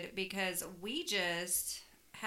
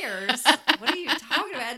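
louder, laughing disbelief; mock confusion; 3 semitones higher, 10 dB louder than usual. Retelling her puzzled reply to the mother-in-law, laughing. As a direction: act baffled, half laughing. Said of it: "I was like".

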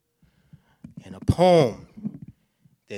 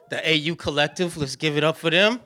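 louder, higher shout-out to the crowd; crowd hype; 6 semitones higher, 10 dB louder than usual. Real, not acted. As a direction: pump up the room for the dancers. Hyping the crowd on stage.

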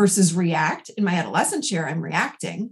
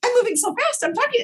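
mimicking rushed adolescent; 14 semitones higher, faster than usual. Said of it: "people sit in the adolescent, they're like".

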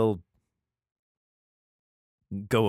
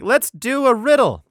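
much higher, louder, gleefully playful; chirpy mock Samson; 12 semitones higher, 9 dB louder than usual. Samson proposing a riddle to the Philistines, high, loud and gleeful. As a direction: play Samson as a giddy game host. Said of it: "He's like".

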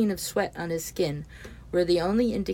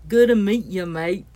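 put-on broad Kiwi accent, louder, higher, vowels bent: "mate" like "mite"; 3 semitones higher, 8 dB louder than usual. Mamoru hearing Kane's greeting in a thick broad Kiwi accent. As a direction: exaggerate the Kiwi accent the way a foreigner hears it. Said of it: "through his ears it sounded like".

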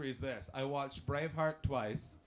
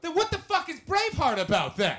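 high, very loud outburst; incredulous full-volume protest; mock indignant shout; 14 semitones higher, 11 dB louder than usual. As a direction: yell it as a baffled challenge. Comic outburst at audience's answer.

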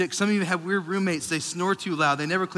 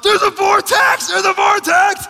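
panicked sleep-yelling, high and loud; screaming; 13 semitones higher, 7 dB louder, slower than usual. Retold or imitated. Husband waking his sleeping wife about a vortex, high screaming. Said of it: "Like, I'm screaming".

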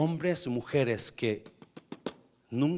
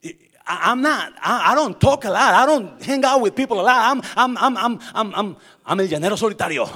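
emphatic confession; 7 semitones higher, 12 dB louder than usual. Real, not acted. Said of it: "¿alguien me entiende aquí?".

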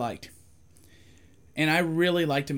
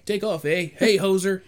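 mock Canadian accent; 5 semitones higher, 5 dB louder than usual. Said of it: "that's how they talk".

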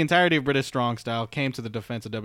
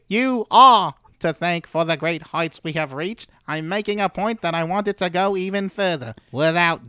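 put-on British accent; 7 semitones higher, 4 dB louder than usual. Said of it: "you sound like Salad Fingers for a second".

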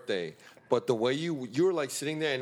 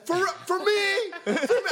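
very high, slow mock amazement; imitating Sean amazed; slower than usual. Imitating Sean welcoming the next friend, very high. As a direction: gasp it like a thrilled host. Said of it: "Sean goes".

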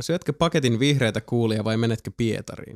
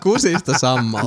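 repeating it amused, through laughter; laughing; 4 dB louder than usual. Real, not acted. Host repeating a friend's crude joke, amused laughter. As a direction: echo the phrase while giggling.

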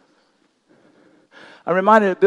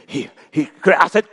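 normal talking voice

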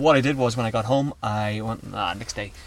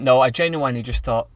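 ordinary delivery

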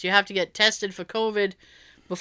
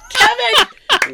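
high, loud retold cheer; thrilled exclamation; 12 semitones higher, 7 dB louder than usual. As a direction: cry it out high and loud, delighted. Delighted reaction to cabbie's rant.

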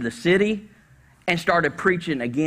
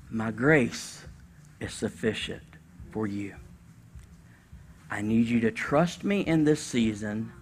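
softer, tender, unhurried reply; gentle voice of God; 2 semitones lower, 5 dB quieter than usual. Pretend. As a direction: answer like a loving father saying no. Voicing God's gentle answer.

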